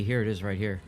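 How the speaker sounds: ordinary delivery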